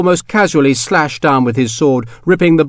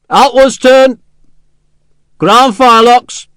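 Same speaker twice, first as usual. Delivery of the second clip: loud, high parade-ground orders, slow on the second; drill commands; 10 semitones higher, 7 dB louder, slower than usual. A narrator's reading. Officer drilling his sepoys on parade, loud commands. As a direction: call the drill across the fort.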